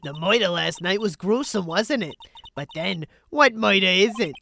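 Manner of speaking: put-on old lady with a New York accent. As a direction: say moida like a Brooklyn grandma. Reads old woman character.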